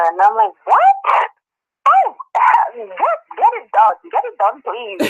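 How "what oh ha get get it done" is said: much higher, squealing with disbelief; thrilled and desperate to get out; retelling her own giddy reaction; excited disbelief. Retold or imitated. Disbelief at job offer, then eager.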